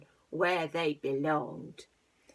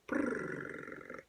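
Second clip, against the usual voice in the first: purring sound; 6 dB quieter than usual. Acted. Cats purr.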